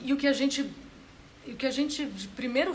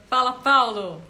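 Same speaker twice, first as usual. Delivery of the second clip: loud, higher-pitched greeting; calling out; 5 semitones higher, 13 dB louder, slower than usual. Real, not acted. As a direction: call out a hello to someone just arriving. Cumprimenta alguém que chega. (cumprimento em voz alta)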